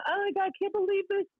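high-pitched imitation of tearful wailing; mock crying. Telling how she cried on the phone, mock crying. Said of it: "So I'm crying".

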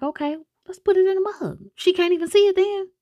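high, light mock scheming voice; a hint of a sneaky boyfriend; scheming suggestion. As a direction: pitch it up like a sly plan. Slater's plan voiced.

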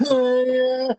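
high, drawn-out cheer; imitated distant call. Dad imitating the cheer he hears across Walmart, drawn-out call. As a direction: mimic a holler from down the aisle. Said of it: "And I hear".

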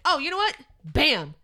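high, loud mock realization, then a punchy bam; sudden exclamation. Acting out someone returning attention, sudden loud exclamation. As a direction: burst out with a loud, acted flourish.

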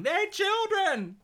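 high, slow, drawn-out shriek; retold scream. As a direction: shriek it out in horror. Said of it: "like, screaming".

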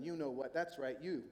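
low, knowing accusation; insinuating devil. Acted. Devil accusing the listener, low insinuation.

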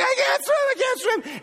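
high, shouted cry that she can't swim; imitating his wife's frantic shouts; panicked wail. Wife panicking in the waves.

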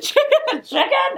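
a playful, failed imitation of the Scouse "chicken" with a guttural ch; attempted Scouse accent. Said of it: "I can't do accents".

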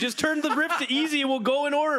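fed up and pleading; exasperated. Acted. Exasperated player yelling at GM.

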